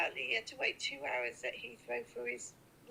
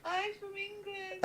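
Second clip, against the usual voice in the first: higher, louder, slow and sing-song; cheery greeting; 8 semitones higher, 6 dB louder, much slower than usual. Pretend. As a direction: call it out brightly and slowly. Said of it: "you say".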